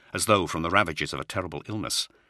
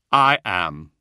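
grave; 7 dB louder than usual. Acted. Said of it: "he said gravely".

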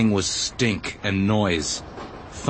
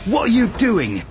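high, indignant raised voice; angry shout; 9 semitones higher than usual. A narrator's reading. Arad catching the villagers fleeing work, angry shout. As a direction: demand it angrily, pitched well up. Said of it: "An angry shout cut through".

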